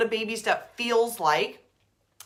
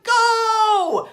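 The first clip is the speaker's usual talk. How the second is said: high loud shouted go; cheering shout; 13 semitones higher, 10 dB louder than usual. Pretend. God urging you on at a race, high loud shout. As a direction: yell it from the sidelines. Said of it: "he's like".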